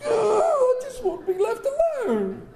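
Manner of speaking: high, cry-laughing mock whine; mock whimper. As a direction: play a half-laughing, overdone plea to be left alone. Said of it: "somebody uses that phrase".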